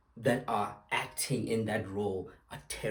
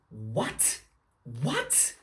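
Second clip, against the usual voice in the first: rising what, climbing higher each time; stunned, pitch shooting up on the last; repeated disbelieving what; incredulous; 3 semitones higher, much slower than usual. Real, not acted. Disbelief at the pundit.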